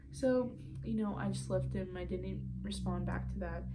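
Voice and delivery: ordinary delivery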